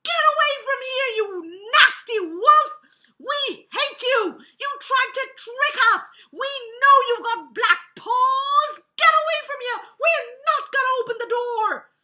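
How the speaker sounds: high, full-force screamed tirade; screaming and shouting. A narrator's reading. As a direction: scream it as terrified, furious kids driving off a wolf. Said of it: "they screamed and shouted and said".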